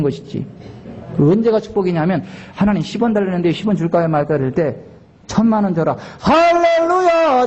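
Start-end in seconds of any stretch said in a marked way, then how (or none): 6.2-7.5 slow, high, loud hallelujah; drawn-out hallelujah. Real, not acted. Pastor bursting into praise before the congregation, slow and high. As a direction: stretch it out in joy.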